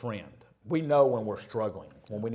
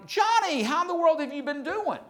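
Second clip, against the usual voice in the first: much higher, louder mock woman's greeting; gushing lady banker; 5 dB louder than usual. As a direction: gush over him like a long-lost friend. Banker greets him effusively.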